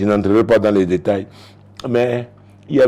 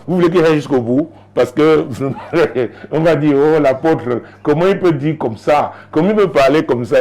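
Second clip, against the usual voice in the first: louder, faster mock indignation; mock outraged listeners; 5 semitones higher, 5 dB louder than usual. Congregation mocking shocked indignation, louder and quick. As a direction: play the shocked congregation protesting.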